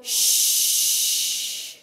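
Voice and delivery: long, drawn-out shh. Shown as a demonstration. Voice coach leading the room in a long shh. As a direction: breathe out a steady shh to show the group. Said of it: "Let's all do a shh".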